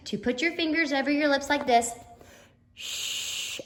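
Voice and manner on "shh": "Shh" as a long hushing hiss; sh sound. Shown as a demonstration. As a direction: hush like quieting a room, no voice. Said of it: "make the S H sound".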